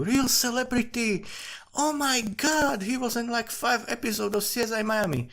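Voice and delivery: hammed-up fan swooning over a bit-part actor; mock gushing excitement. Mocking local news fawning over a minor TV actor, high squealing gush.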